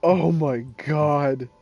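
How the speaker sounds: loud, slow words between laughs; drawn-out gasps of disbelief; helpless laughter. Real, not acted. Player crying with laughter at the game. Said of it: "I'm crying".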